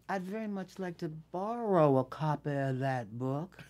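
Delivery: imitated Southern politeness; Southern accent. Co-star asking to borrow the book, Southern accent. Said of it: "he started talking with the Southern".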